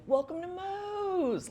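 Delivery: high, drawn-out imitation of a kid calling; little-kid voice, stretched holler; kid yelling. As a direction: sing it out like a child from down the hall. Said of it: "this little voice from down the hall".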